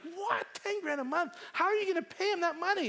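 high, incredulous questions; shocked disbelief. Retold or imitated. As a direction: gasp it out in disbelief. Friends shocked at ten grand.

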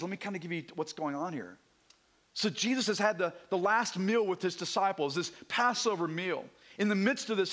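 normal talking voice

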